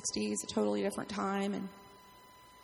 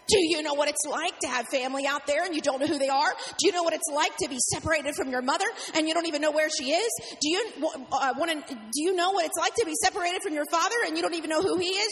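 louder, rising, pleading rant; angry rant; 4 semitones higher, 4 dB louder than usual. Retold or imitated. James telling the pastor about his lost family, loud rant. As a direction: pour the grief out in a loud rant. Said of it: "he just goes off on a rant".

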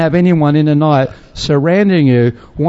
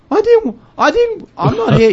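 high-pitched, acted-out protest; protesting denial. Accused missionary answering the villagers, high mock protest. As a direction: protest your innocence in a raised pitch.

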